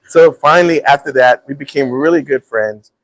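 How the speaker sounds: ordinary talk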